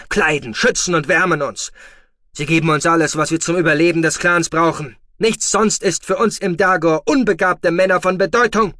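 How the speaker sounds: loud, high raging lecture; furious shouting. Acted. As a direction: shake sense into him. Foster father shakes and berates boy.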